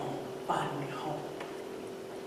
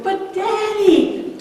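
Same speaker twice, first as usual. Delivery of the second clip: put-on pleading child; 14 semitones higher, 6 dB louder, much slower than usual. Daughter pleading with father.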